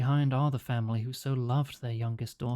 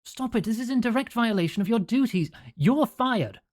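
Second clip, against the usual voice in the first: high, raised voice; doctor scolding the caregiver; fuming doctor; 10 semitones higher, 4 dB louder than usual. Acted. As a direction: tell him off and fire him. Fuming doctor keeps shouting.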